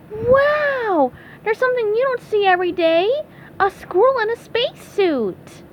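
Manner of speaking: high, wide-eyed cartoon wonder; amazed SpongeBob.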